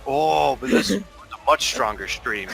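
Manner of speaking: loud, slow mock-awed commentary; mock amazed. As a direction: marvel at it like a nature narrator. Joking over peeing sounds.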